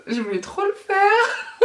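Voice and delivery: high, drawn-out, laughing confession; giggling. Real, not acted. Vlogger apologizing for her singing, giggling. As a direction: let the giggle push your voice up.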